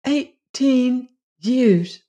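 high and very slow, in wonder; stunned echo. A narrator's reading. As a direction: let it hang, stunned. Jane echoes it, stunned.

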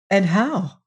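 ordinary delivery

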